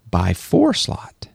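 hoarse, breaking off to a whisper; voice giving out. Real, not acted. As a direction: voice cracking into a whisper. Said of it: "My voice is giving out".

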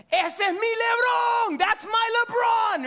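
high, drawn-out full-voice scream; screaming proud dad. Retold or imitated. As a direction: yell it like a dad cheering from the stands. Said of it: "his dad starts screaming".

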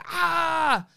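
sudden high frustrated "Ah!"; frustrated yell. Retold or imitated. Mum stressed about a rushed morning, exasperated yell. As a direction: let out a sharp exasperated yell.

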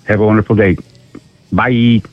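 ordinary talk

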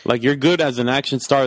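ordinary talk, nothing marked

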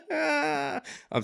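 helpless high laugh into an apology; real laughing. Real, not acted. Apologising for laughing at the cosmic self, laughing. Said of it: "I shouldn't have laughed at that".

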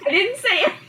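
giggling through the words; laughing. Real, not acted. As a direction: laugh while you speak. Embarrassed at fart in class.

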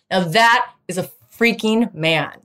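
normal talking voice

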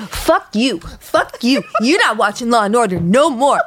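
imitated hurt fury, climbing in pitch; mock indignant outburst. Daughter cuts off her accusing mom, mock indignant. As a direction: act out a high, offended tantrum.

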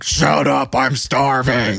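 slow, drawn-out growly outburst; Farley, snapping. Retold or imitated. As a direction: snap at a friend who is guarding the fries. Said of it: "And Farley's like".

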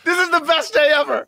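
high, loud burst of delight; gleeful exclamation. Real, not acted. Co-host delighted by a friend's mistake, gleeful. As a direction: shout it like the best news ever. Exclaims in glee, then repeats it.

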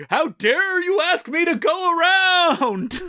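high, slow indignant imitation; hammed-up furious Billy Gilbert; mock angry outrage. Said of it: "much angrier".

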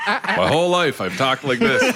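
usual speaking voice

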